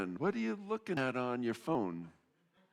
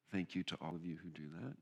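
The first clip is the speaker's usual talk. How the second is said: soft thanks; 6 semitones lower, 10 dB quieter, faster than usual. Real, not acted. Quiet thanks to volunteers.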